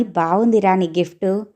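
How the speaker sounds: plain reading voice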